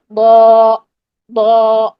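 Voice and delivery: با as a long, heavy Arabic ضا, rounded toward "aw"; heavy ضاد with fatha. Shown as a demonstration. Teacher models for students, long heavy ضا. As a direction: hold با full, pushed back toward the throat. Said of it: "اس طریقے سے".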